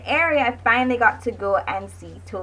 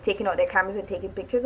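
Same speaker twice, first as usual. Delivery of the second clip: usual speaking voice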